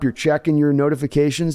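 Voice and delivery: usual speaking voice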